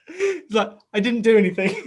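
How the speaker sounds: can barely get words out for laughing. Real, not acted. Retelling her son's denial while laughing.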